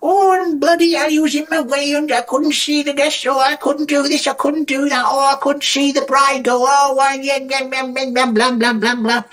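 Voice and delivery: high-pitched mock rant; exaggerated high voice running into babble; complaining guest. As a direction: imitate a guest whingeing on and on. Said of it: "guests who suddenly go".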